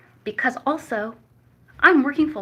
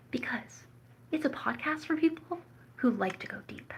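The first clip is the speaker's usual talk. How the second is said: soft secretive whisper; 3 semitones higher, 7 dB quieter than usual. Acted. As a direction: whisper it like a promise. Teasing the podcast's depth.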